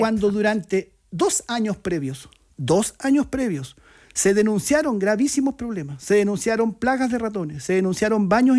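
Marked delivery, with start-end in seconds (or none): 0.0-3.8 "dos años previos" drawn out slowly, each word weighted; slow emphatic stress. Real, not acted. Stressing two years of complaints.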